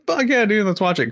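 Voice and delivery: high-pitched, pumped-up yes to a friend; retold burst of enthusiasm; acted eagerness. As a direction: jump on the idea, voice shooting up. Acting out own eager reply.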